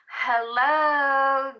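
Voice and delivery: loud greeting. Acted. Calling out hello to the viewer, loud and high. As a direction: sing out the hello to get attention.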